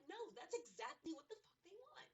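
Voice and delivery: sharp and high, refusing to be beaten; retold defiance, pitched well up; defiant inner voice. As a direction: snap back at the doubters in your head. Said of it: "but I'm like".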